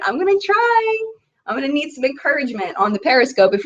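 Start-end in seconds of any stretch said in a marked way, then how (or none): 0.1-1.0 much higher, mock-timid little voice; squeaky high promise. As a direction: squeak it up like a nervous kid. Third repeat of promise.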